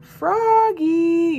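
high, loud drawn-out call; yelled name. A narrator's reading. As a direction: sing the name out loudly in two long notes. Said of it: "yelled his friend Max".

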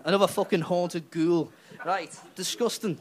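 usual speaking voice

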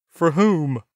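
near hysterical. A narrator's reading. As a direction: crack it out in panic. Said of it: "practically hysterical".

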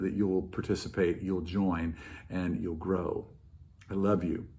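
soft, low and sincere; tender pastoral farewell; warm sign-off. Real, not acted. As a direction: settle down into a quiet blessing.

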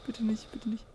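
hushed, voiceless begging; soft anxious whisper; whispered plea. Real, not acted. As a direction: whisper it, afraid of what comes. Pleading while creeping through horror game.

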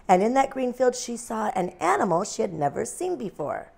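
ordinary delivery

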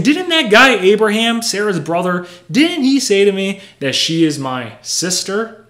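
high, loud acted protest; indignant accusation. Acting Abimelech blaming Abraham to God.

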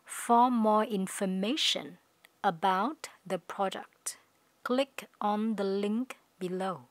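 slow, careful, word-by-word reading; slow teacher demo of the sentence; slow model reading. Shown as a demonstration. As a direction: read it slowly for learners to copy. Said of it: "các bạn phát âm chậm với mình".

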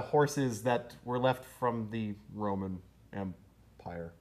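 slow, fading away softly; trailing off. Real, not acted. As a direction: let it die out slowly. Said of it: "trailing off uselessly".

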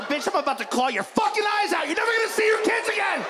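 furious cat threat climbing high and fast; escalating, high-pitched mock menace; threatening cat. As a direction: voice a raging cat, building to a high rush. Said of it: "They're just letting you know".

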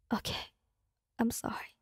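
small, hushed, acted apology; understated tearful near-whisper. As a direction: answer softly, as if just done crying. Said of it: "I don't like seeing you cry".

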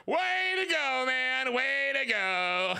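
high, drawn-out sarcastic cheer; slow singsong jeer; mock congratulation. Real, not acted. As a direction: stretch each phrase, starting high and sliding down. Mock congratulating Doug for demonetization.

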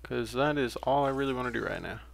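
ordinary talk, nothing marked

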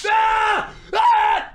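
two loud, high shrieks; panicked screams. Real, not acted. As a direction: scream in sudden alarm. Screams as danger strikes.

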